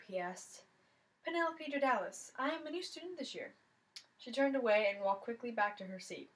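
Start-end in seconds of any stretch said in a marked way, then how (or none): 1.2-3.5 a hint of a foreign girl, higher; calm and slightly foreign; lightly voiced foreign girl. New girl answering the jock, lightly voiced foreign girl.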